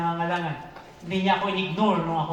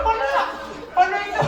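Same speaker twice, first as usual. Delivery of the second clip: laughing; 13 semitones higher, 4 dB louder, faster than usual. Retold or imitated. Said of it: "Sabi ko".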